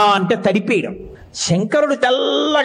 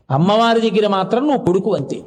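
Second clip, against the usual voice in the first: usual speaking voice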